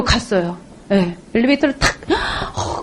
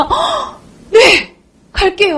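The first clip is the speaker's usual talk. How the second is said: eager yes; 8 semitones higher, much slower than usual. Retold or imitated. Eager newbie answers sponsor.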